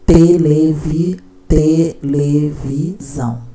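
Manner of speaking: drawn out syllable by syllable, very slow. Shown as a demonstration. Teacher showing a child's reading, slowly sounding it out. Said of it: "Porque ela leu até aqui".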